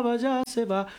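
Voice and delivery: usual speaking voice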